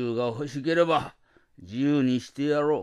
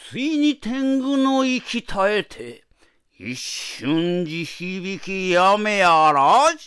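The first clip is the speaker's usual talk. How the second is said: loud, slow, grand narration; dramatic narration; 6 semitones higher, 7 dB louder, slower than usual. A narrator's reading. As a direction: declaim it grandly.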